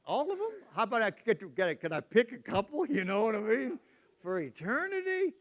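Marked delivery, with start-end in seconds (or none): 0.1-1.9 mock shock. Balking at eternal family. 2.3-5.3 mock disbelief. Balking at eternal family.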